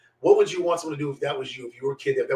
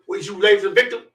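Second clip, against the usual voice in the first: louder, higher sergeant's challenge; curt interruption; 5 semitones higher, 7 dB louder than usual. Retold or imitated. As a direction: cut in as an officious sergeant. Sergeant cuts him off.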